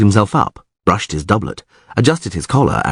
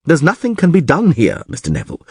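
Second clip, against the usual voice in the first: snorted dismissal; 7 semitones higher than usual. A narrator's reading. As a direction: dismiss him with scorn. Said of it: "snorted Crep".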